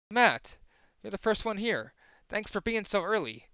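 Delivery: high, friendly welcome to the first one in the classroom; Monika's voice. Acted. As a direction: pitch it up and greet brightly like Monika. Voices Monika greeting the player.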